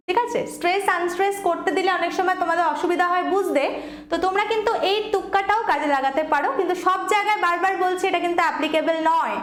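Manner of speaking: normal talking voice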